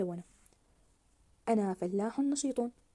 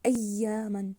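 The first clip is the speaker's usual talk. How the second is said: "أياما" with the ي doubled and drawn out; stressed doubled letter; 4 dB louder, much slower than usual. Shown as a demonstration. Teacher modelling how to read the word, stressed doubled letter. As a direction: press firmly on the ي in "أياما". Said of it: "كيف نقرأ كلمة أياما؟".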